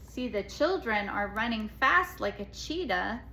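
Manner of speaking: usual speaking voice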